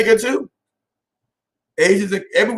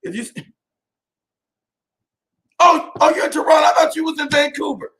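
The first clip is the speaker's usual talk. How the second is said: surprised shout; 8 semitones higher than usual. Real, not acted. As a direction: blurt it out in surprise. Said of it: "I was like".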